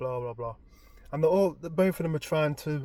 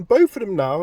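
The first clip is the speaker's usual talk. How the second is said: usual speaking voice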